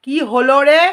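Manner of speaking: very loud, high shouted question; exasperated yell. Real, not acted. Mother breaks off vlog to check on child, exasperated yell.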